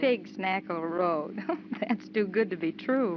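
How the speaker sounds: giggling through the road name; words bubbling out of a laugh. Acted. Alice laughs at the silly road sign while lost. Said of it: "laughing at a name of a place".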